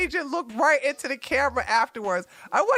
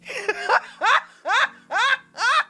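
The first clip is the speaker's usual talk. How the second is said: high laugh; 9 semitones higher than usual. Real, not acted. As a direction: burst out laughing. Laughing at the clip.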